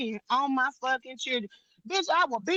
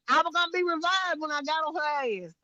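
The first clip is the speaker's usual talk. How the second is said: usual speaking voice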